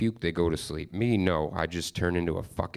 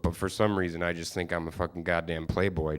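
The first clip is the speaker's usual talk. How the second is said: usual speaking voice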